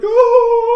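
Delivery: loud high wail, drawn out; long high-pitched mock scream; imitated scream. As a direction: holler "oh" like a panicked crowd. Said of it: "they're screaming and hollering at him".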